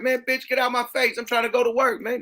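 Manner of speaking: high-pitched irritated protest; acted exasperation. Man trying to get to work, exasperated retelling. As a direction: imitate a man fed up and pushing past.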